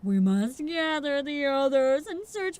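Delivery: grand, slow and stately voice; pompous gentleman. Acted. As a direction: play it like a self-important gentleman. Same character continues.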